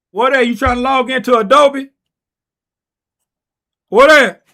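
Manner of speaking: high, loud call to someone in another room; loud shout to a family member off-mic. Real, not acted. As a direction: yell it across the house.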